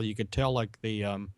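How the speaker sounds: ordinary talk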